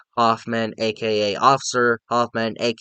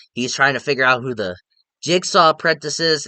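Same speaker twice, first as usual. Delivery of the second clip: ordinary talk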